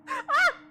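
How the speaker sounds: very high, loud yelp; short piercing scream of fright; sudden squealed "ah"; startled shriek. Real, not acted. Startled by the game.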